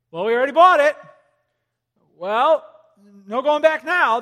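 a hint of defeated homeowner; mock resigned buyer. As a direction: lightly voice the stuck buyer giving up. Voicing a trapped buyer.

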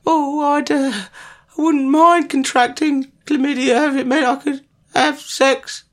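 high, slowed mock-mournful take; hammed-up high sorrowful reading; mock sad voice. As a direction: overdo it as a hammed-up lament. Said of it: "I'll say it one more time".

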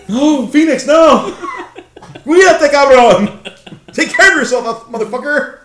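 high, loud laughing fan shout; alarmed yelling. Retold or imitated. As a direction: yell at the wrestler to be careful. Fan yells at hurt wrestler.

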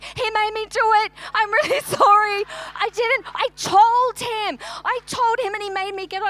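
high, loud, rushed pleading; flustered, near-laughing apology to police; panicked apology. Retold or imitated. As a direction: blurt it all out to the police in a panic. Blurting excuses to the police.

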